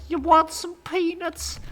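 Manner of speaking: very high, loud chirpy offer; high-pitched flight attendant offering peanuts. Retold or imitated. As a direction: squeak it out like the peanut lady. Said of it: "she was like".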